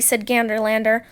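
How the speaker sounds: plain reading voice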